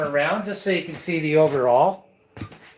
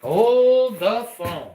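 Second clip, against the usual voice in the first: mock astonishment; 7 semitones higher, much slower than usual. Host mock amazement.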